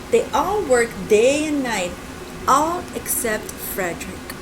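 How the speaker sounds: put-on gentle read-aloud for kids; storybook narration. A narrator's reading. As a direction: read it like a picture book.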